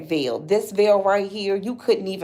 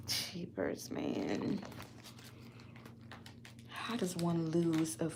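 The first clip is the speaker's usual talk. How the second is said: much softer, close to a murmur; despairing, quiet; 4 semitones lower, 13 dB quieter than usual. Real, not acted. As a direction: speak low and deflated, almost to yourself. Despairing over the missing dress.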